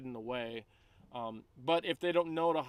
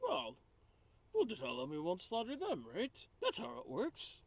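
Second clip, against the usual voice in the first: high, soft, airy put-on voice; innocently confident suggestion; naive peacemaker; 8 semitones higher, 5 dB quieter than usual. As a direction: propose it lightly and sweetly. Player voices own character.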